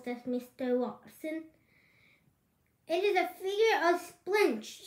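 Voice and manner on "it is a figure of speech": raised, higher-pitched shout. A narrator's reading. Eugenia correcting the others about the movie title, shouted. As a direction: shout it out insistently. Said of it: "shouted Eugenia".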